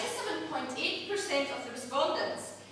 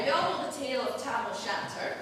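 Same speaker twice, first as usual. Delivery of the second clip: normal talking voice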